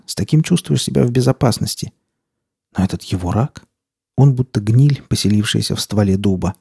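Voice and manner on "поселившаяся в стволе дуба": plain reading voice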